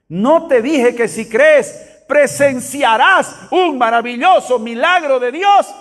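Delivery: slow, high-pitched proclamation; proclamación enfática. Acted. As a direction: declare it emphatically, word by word. Cita proclamada con énfasis. (proclamando con énfasis, palabra por palabra)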